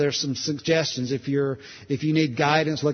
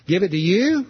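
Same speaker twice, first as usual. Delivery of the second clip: startled disbelief; 5 semitones higher, 6 dB louder than usual. Acted. Startled question to the Lord.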